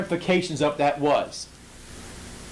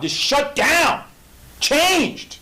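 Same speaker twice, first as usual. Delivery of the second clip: emphatic preaching; 11 semitones higher, 4 dB louder, much slower than usual. Real, not acted. Preacher hammering God's sovereignty.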